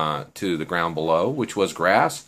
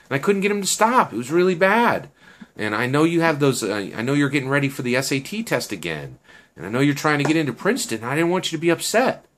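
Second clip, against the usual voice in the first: pleading explanation; 6 semitones higher, faster than usual. Acted. Pleading apology to friend.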